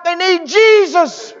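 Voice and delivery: high, loud, slow proclamation; loud preacher's cry; cried out. Retold or imitated. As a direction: cry it out to the crowd. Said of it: "And I cried out".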